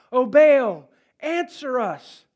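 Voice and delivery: desperate call. Acted. Prophets calling on Baal.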